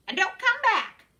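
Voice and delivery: very high, loud parrot screech. A narrator's reading. Parrot seeing Mickey off, loud high screech. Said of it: "who screeched".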